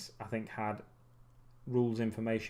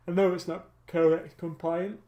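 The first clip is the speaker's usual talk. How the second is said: talking through a real yawn, higher and louder; tired, yawning; 7 semitones higher, 7 dB louder than usual. Real, not acted. Vlogger getting tired while planning paint scheme, yawning through words. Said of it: "Oh, I'm getting tired for some reason".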